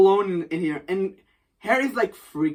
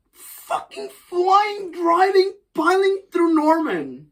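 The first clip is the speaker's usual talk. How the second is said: slow, high, drawn-out emphasis; excited buildup; 9 semitones higher, slower than usual. Real, not acted. Telling friends the climax, drawn out and high. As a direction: stretch out the big moment. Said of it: "excited retelling of climax".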